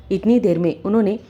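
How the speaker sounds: plain reading voice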